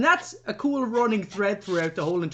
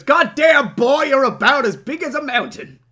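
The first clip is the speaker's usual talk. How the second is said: loud, high, hammed-up drawling greeting; a loud, higher, hammed-up Jack Nicholson; Jack Nicholson impression; 6 semitones higher, 7 dB louder than usual. McMurphy greeting the huge chief, hammed up, louder and higher. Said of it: "I can't do a Jack Nicholson impression".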